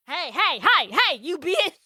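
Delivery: hammed-up Kentucky accent, swooping up high; hard Kentucky accent. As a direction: call out in an exaggerated Kentucky twang. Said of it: "he hits the Kentucky accent so hard".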